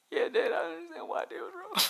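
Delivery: mock child answering dad, high and meek; son's voice. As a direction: pipe up like a sheepish kid.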